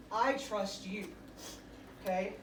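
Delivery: ordinary delivery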